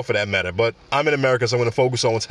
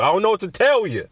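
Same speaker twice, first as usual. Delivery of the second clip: pitched up, playful no-apology; higher mock shrug; 7 semitones higher, faster than usual. Shown as a demonstration. Shrugging to podcast listeners, higher and cheeky. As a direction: lift the repeat into a cheeky shrug. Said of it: "I'm sorry".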